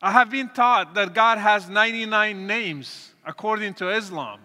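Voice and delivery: ordinary talk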